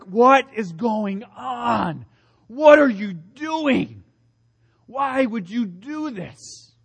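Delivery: slow, high, rising disbelief; aghast. Retold or imitated. Judas aghast as Mary anoints Jesus, slow shocked questions. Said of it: "and he's like".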